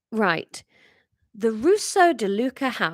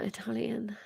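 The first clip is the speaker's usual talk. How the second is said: whispered aside; 11 dB quieter than usual. Real, not acted. Whispered aside before voicing a character.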